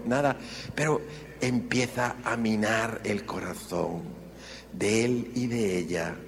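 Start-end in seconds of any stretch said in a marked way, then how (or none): none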